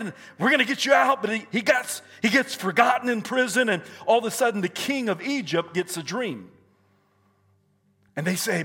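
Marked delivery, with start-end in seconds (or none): 0.4-1.2 high, louder cheer of a promise; excited quote in mid-sermon; acted excitement. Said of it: "they're excited". 1.2-6.5 high, driving sermon delivery; rousing pulpit storytelling; forceful preaching. Acted.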